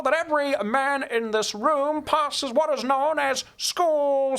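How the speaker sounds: high, slow, stretched-out dorm master's speech; Kevin Connors. Retold or imitated. Dorm master charges boys with passing exams, imitated high drawling proclamation. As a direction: proclaim it like an old cowboy commander. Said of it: "That's how he talked".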